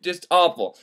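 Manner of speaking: ordinary delivery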